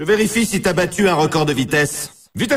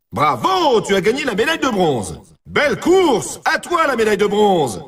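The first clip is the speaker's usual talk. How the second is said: high-pitched cheering announcer; game announcer cheering a podium finish; excited congratulations; 5 semitones higher than usual. Acted. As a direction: celebrate the win with a high, rising cry on each cheer. Announcing a bronze medal win.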